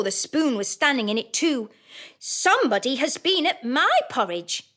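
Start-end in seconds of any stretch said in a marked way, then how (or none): 2.2-4.6 a touch higher, mid-sized bear; lightly voiced middle bear, a bit higher. Middle bear complains to his family, a touch higher. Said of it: "in his middle-sized voice".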